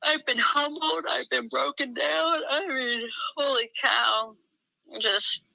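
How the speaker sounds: holding back tears as she admits it; choked up. Real, not acted. Telling a friend her hard year, near tears. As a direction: voice rising and breaking, slow. Tearful confession of hard times.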